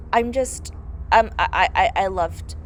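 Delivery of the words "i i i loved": ordinary talk, nothing marked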